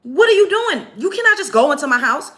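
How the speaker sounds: high, loud, outraged "what are you doing"; mock-furious homeowner; indignant confrontation. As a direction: retell her demanding answers from the intruder. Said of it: "She's like".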